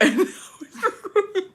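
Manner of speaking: real helpless high-pitched laughing; words dissolving into squeaky laughter; laughing to tears. Real, not acted. Storyteller recalling her own meltdown, cracking up. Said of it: "I'm literally crying thinking about this".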